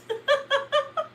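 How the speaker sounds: high-pitched peal of laughter; high laughing. Real, not acted. Teasing sister on the live, high laughter.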